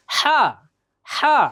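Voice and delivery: হা voiced high and strong from the throat; throaty ha. Shown as a demonstration. Teacher shows students the strong হা. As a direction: push হা out from the throat. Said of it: "হার উচ্চারণটা হবে".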